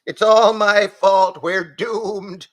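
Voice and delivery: plain goat lament; despairing goat. A narrator's reading.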